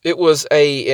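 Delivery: usual speaking voice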